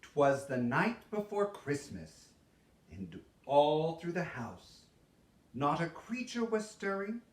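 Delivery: plain reading voice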